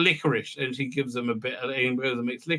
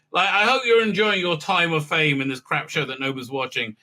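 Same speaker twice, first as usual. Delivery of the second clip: ordinary talk, nothing marked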